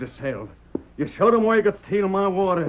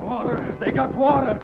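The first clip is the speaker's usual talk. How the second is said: higher, startled call at the sight of water; excited shout; 8 semitones higher than usual. Acted. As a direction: call out the discovery in surprise. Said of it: "Then suddenly Joel shouted".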